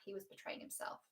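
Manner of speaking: whispered, confiding the real lesson. Shown as a demonstration. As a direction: drop to a hushed whisper to drive the point home. Restates the point in a whisper.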